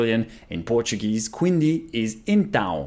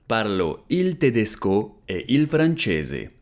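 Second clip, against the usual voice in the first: slow, careful, clearly spaced words; slow model repeat; 2 semitones lower, slower than usual. Shown as a demonstration. Teacher repeating for students, slow and careful. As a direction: say it slowly for learners to copy. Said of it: "slow repeat of model".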